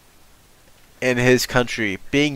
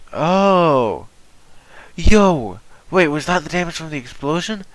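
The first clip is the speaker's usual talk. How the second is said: excited; 5 semitones higher, 3 dB louder than usual. Real, not acted. Excited reaction to game scene.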